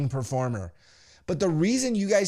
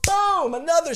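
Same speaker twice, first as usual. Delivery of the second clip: very high, exclaimed boom; excited impact; 12 semitones higher, 3 dB louder than usual. Retold or imitated. Telling of another Superman punch, excited high boom. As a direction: launch it high as the hit lands.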